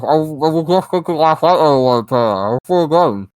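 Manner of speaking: words through a mouthful of Cheez-Its; mouth full. Real, not acted. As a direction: talk around a mouthful of snacks. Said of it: "I can't hear shit through your mouth".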